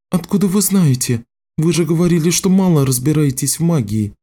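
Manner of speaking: put-on high-pitched questioning girl; girl character pressing with questions; young girl's voice. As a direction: challenge him in a high girlish voice. Girl questions Richard in dialogue.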